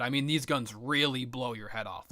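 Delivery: ordinary delivery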